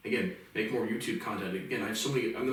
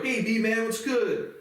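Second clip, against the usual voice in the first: mimicking brother; 10 semitones higher, 4 dB louder, much slower than usual. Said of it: "from my brother".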